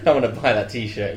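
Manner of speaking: ordinary talk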